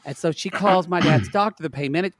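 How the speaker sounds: ordinary delivery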